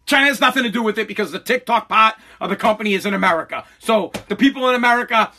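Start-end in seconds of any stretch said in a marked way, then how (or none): none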